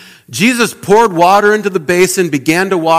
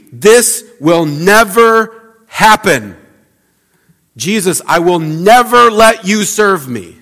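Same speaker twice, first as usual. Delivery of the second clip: higher, louder, slow emphatic refusal, every word leaned on; strong refusal; 4 semitones higher, 5 dB louder, slower than usual. Retold or imitated. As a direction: stretch out the refusal as if it could never happen. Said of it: "it's really strong".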